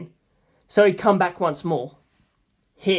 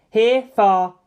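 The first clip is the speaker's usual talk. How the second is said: ordinary delivery, nothing marked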